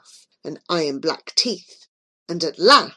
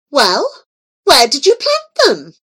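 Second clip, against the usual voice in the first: higher, louder, pressing for an answer; demanding; 6 semitones higher, 6 dB louder than usual. A narrator's reading. Queen pressing Joy for the acorns, demanding. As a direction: insist she show where they are. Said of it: "she demanded".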